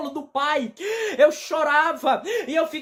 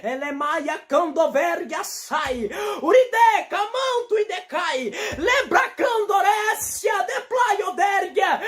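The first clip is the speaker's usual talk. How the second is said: speaking in tongues; 4 semitones higher, slower than usual. Real, not acted.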